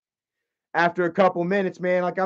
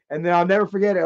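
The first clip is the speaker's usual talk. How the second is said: ordinary delivery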